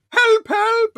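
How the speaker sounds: very high, loud squeal; mock panicked cry. Fan quoting Moss and Roy calling for help, squealing. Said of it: "Moss and Roy going".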